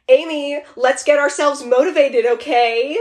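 high, loud chirpy mom voice; imitating mom. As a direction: do your mom's peppy wake-up call. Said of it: "You just do it so well".

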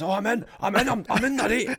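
high, loud, thrilled yelling; excited. Retold or imitated. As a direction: burst out in giddy triumph. Retelling getting into the game.

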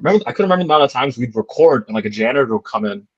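ordinary talk

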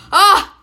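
loud high burning gasp; spicy gasp cry. Real, not acted. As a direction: cry out at the heat. Reacting to the spicy sauce. (lauter Aufschrei wegen Schärfe)

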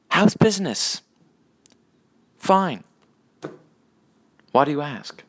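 high-pitched joke dialogue with long pauses; joke characters' exchange. Acted.

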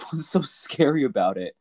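ordinary delivery

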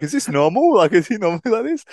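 high, bewildered quoted panic; a mate alarmed by Brad's antics on the tube; freaking out. Retold or imitated. Freaked-out high voice of a mate, imitating him. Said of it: "Mitch was, like, freaking out".

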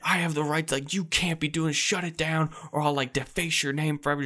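hamming up a furious man's rant; retold screaming. Rights holder screaming at the comedians, mock furious. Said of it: "just screaming at him".

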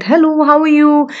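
high, cheerful phone greeting; bright hello. Retold or imitated. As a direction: overcompensate with cheer. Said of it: "And I said".

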